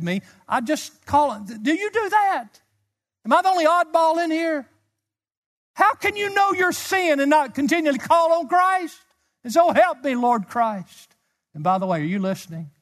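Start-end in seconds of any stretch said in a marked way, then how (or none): 1.6-9.0 high, loud preacher's questioning; impassioned challenge. Real, not acted. Preacher challenges congregation, crying out. 9.4-11.1 high, loud appeal to Christ; crying out. Real, not acted. Preacher cries out to Christ.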